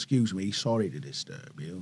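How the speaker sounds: soft, low, bad Welsh accent; a lightly done Welsh referee; dodgy Welsh accent. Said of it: "dodgy Welsh accent alert".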